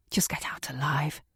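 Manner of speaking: soft whisper; an inner voice urging her on. Retold or imitated. Her own inner voice telling her to survive, whispered. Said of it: "a little voice had said to me".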